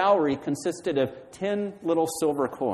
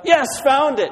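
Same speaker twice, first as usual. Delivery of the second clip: loud, high triumphant cry; excited shout; 11 semitones higher, 9 dB louder, slower than usual. Pretend. Preacher acting out someone finding lost car keys, excited shout. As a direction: shout it out as a happy mock outburst. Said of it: "shouted out".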